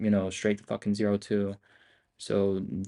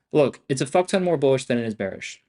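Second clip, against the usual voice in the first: excited; 4 semitones higher, 6 dB louder than usual. Real, not acted. Excited market call.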